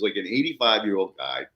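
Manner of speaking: ordinary delivery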